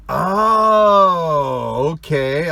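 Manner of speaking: loud, high drawn-out oh; surprised realization. Retold or imitated. Boss realizing what his friend meant, loud surprised oh. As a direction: let the understanding dawn out loud. Realization cry at Michael's answer.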